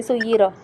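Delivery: "सुय्यिरा" ending on the vowel, the t left out; dropped final t. Shown as a demonstration. Teacher imitating careless readers who skip the letter, final t dropped. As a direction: cut the closing t off "सुय्यिरा" entirely. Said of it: "बिल्कुल छोड़ ही देते हैं".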